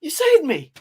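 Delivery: put-on rescued Scottish soldier thanking the kid in a squeaky voice; mock grateful squeak. Rescued Scot, gushing thanks.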